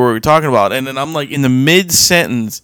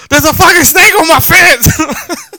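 high, hammed-up panic; mock panicked shriek; 13 semitones higher, 4 dB louder than usual. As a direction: imitate a friend's squealing alarm, close to laughing. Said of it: "I couldn't stop laughing because you were like".

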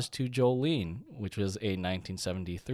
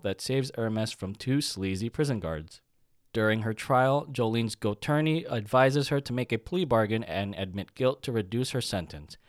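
slightly raised newsreel narration; rapid old-time reporter; 3 semitones higher, 4 dB louder than usual. Acted. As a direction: read it like an old newsreel announcer. Hammed-up synopsis read.

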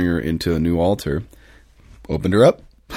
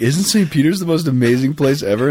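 higher, gushing delight over the basilica; gushing enthusiasm; 7 semitones higher than usual. Retold or imitated. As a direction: play the wide-eyed admirer, all wonder. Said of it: "And he's like".

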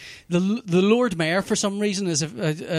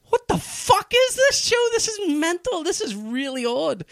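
incredulous; 12 semitones higher than usual. Retold or imitated. Retold shocked reaction.

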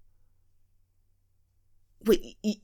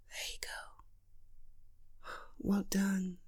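whispering; 18 dB quieter, much slower than usual. Acted. Whispered reassurance.

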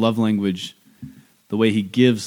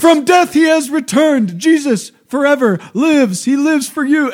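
put-on much higher, loud, slow shouting child preacher; preaching child; 7 dB louder than usual. Mother imitating her son's wild sermon, high and shouted. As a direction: shout it out like a little boy leading a procession. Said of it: "it's just like".

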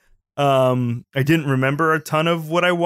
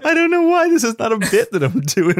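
laughing; 6 semitones higher, 4 dB louder than usual. Real, not acted. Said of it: "It just legitimately gets me".

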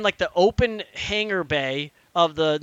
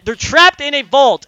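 loud, raised, incredulous protest; exasperated; 8 semitones higher, 11 dB louder than usual. Real, not acted. Player protests to friends about the vault scene, exasperated. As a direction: hammer home the absurdity, louder.

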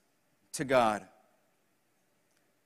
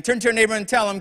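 usual speaking voice

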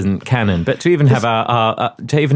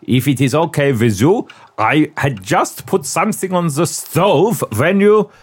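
hammed-up German accent. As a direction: play the scene in German-accented English. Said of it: "my German accent's been very good".

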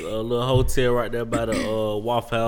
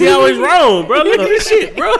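laughing; 10 dB louder than usual. Real, not acted. Teasing cohost over episode count.

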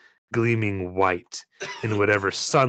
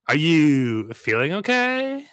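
louder Andrea voice; 4 semitones higher, 4 dB louder, slower than usual. Shown as a demonstration. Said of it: "I'll do one for Jay".

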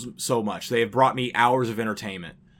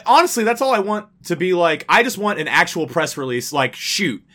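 ordinary delivery

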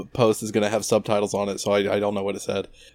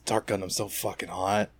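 mock smitten murmur; 8 dB quieter than usual. Smitten with the Dark Gundam, mock lustful murmur. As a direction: drop it right down, as if besotted.

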